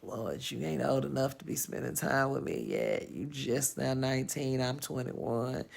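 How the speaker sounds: weary mock exasperation, hushed; exasperated sigh. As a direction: groan it out to a boy too young for her. Retelling her own exasperated reply.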